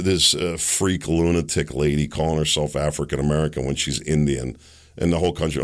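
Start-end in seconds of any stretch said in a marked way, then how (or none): none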